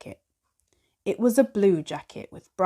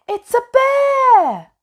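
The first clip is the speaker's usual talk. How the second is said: a much higher, louder, slow shriek of fright; terrified cry; 10 dB louder, slower than usual. Acted. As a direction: scream it as the bear appears. The bear is discovered.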